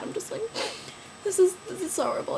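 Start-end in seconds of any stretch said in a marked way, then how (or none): 1.2-2.4 high, whiny self-pity; mock sad whine. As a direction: pout the line in a mock-tragic high voice. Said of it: "I'm all sad".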